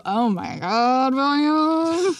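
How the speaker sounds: slow, rising swoon. Real, not acted. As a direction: melt over the name. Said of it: "swooning over him".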